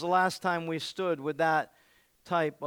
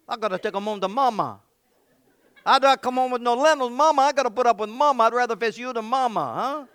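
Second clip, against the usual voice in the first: higher, louder comic Italian; hammed-up Italian guarding his lentils for mama; mock Italian rant; Italian accent; 5 semitones higher, 8 dB louder than usual. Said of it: "I read 'em like that".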